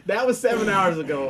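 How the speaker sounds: laughing. Real, not acted. Host laughing about how long his knee has hurt. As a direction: push it out between laughs.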